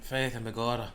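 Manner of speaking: lightly voiced Irish greeting; mock Irish accent, understated. Irish voice on request.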